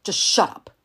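loud barked yell. Acted. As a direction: yell him into silence. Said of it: "he yelled".

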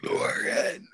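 name burped out on a belch; burping the words. Pretend. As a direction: belch the name in one long burp. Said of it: "it's so fucking creepy".

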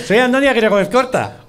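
"Andoni" lifted high, announced proudly; mock grand introduction. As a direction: present yourself like the winery's owner. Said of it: "tenía que haber ido y decir".

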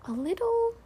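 very high, small voice; little creature searching for the word; hesitant Piglet. A narrator's reading. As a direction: trail into a tiny, unsure squeak. Piglet's hesitant thought, searching for words.

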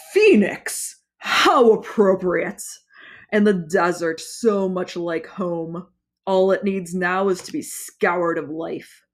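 gloating villain. Acted. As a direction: relish every word.

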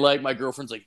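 usual speaking voice

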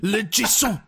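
put-on lightly French-accented name; a hint of a French father; French dad voice. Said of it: "the dad comes along and goes".

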